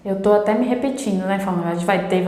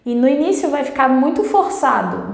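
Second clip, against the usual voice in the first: ordinary delivery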